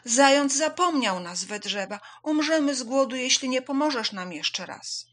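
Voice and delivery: high, loud plea; urgent calling out. A narrator's reading. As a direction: beg loudly for help. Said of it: "zawołała".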